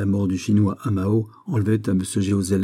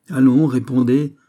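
louder, pressing demand; insisting; 4 semitones higher, 6 dB louder than usual. A narrator's reading. The magistrate insists the prisoner answer, pressing. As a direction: push him to answer now. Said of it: "insista le magistrat".

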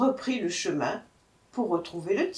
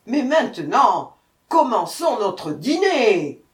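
loud, emphatic tiger demand; growling tiger; 4 semitones higher, 9 dB louder than usual. A narrator's reading. Hungry tiger announcing dinner to the brahman, growling. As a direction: push it out hard, stretching the end. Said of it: "grogna le tigre".

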